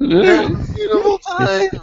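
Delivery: hammed-up falsetto cry; falsetto wail. Falsetto sign-off, laughing crying.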